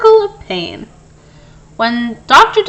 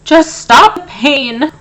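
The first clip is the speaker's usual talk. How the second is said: slow, louder pleading whimper; 6 semitones higher, 6 dB louder, slower than usual. A narrator's reading. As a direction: beg slowly through the pain. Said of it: "whimpered the fox".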